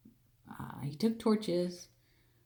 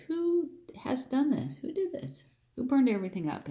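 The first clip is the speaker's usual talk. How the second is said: high-pitched demand to know who did it; acted-out outraged question, pitched high; indignant questioning; 8 semitones higher than usual. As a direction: voice jumps up as the wronged crowd asks. Philistines' angry question acted out.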